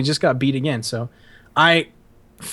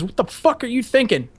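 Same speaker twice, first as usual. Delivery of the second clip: higher, sharp demand; drill instructor demand; 6 semitones higher than usual. Retold or imitated. Drill sergeant grilling the recruit about his food. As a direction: snap the question at the recruit. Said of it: "He goes".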